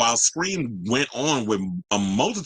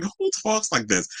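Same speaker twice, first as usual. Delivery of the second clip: incredulous; 9 semitones higher than usual. Retold or imitated. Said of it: "And I was like".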